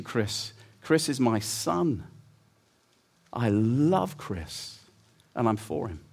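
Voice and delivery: put-on a father gently telling his children he loves them; tender voice of God. God speaking love over people.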